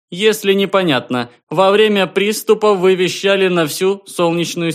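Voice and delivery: high, loud official announcement; a distant station calling out formally; formal observer voice. A narrator's reading. Observer explains over the beam.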